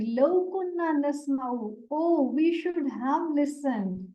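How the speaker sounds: usual speaking voice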